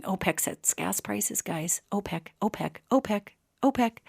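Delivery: hushed aside. Real, not acted. Reminding the viewers who sets gas prices, hushed aside. Pointed aside repeating OPEC.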